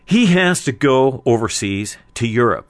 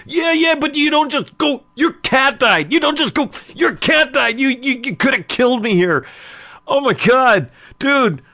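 hammed-up, very high-pitched outrage; distraught scolding; 14 semitones higher, 5 dB louder than usual. Man berating brother for blunt news, distraught. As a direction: scold him in a high, upset wail.

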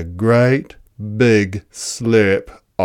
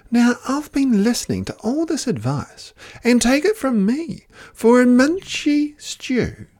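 high, fussy Echidna. Acted. Echidna lectures the animals on stew ingredients. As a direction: lay down the law on stew.

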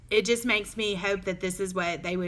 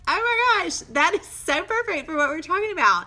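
high, loud delighted gasp of joy; excited gush; 10 semitones higher, 7 dB louder than usual. Retold or imitated. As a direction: relive the thrill, much higher and louder. Retelling her delight at note.

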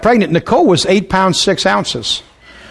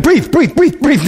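higher, rhythmic repeated urging; mock breathing coach; 6 semitones higher, slower than usual. Clueless husband coaching his wife through contractions, mock urgent. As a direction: coach her breaths in high bursts. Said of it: "I just kept saying".

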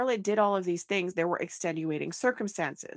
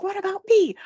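whiny selfish plea; 13 semitones higher, 9 dB louder than usual. Retold or imitated. Voicing Anna's selfish plea.

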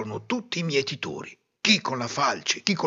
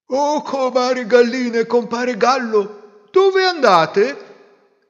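high, slow squawking goose; drawn-out honking goose call; 10 semitones higher, 7 dB louder, slower than usual. Acted. Goose character speaks.

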